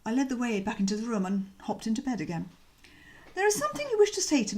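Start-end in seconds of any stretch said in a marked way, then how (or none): 3.3-4.6 slipped into Bertie's higher voice by mistake; voicing the wrong character, pitched higher. Shown as a demonstration. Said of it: "ooh, sorry, wrong voice".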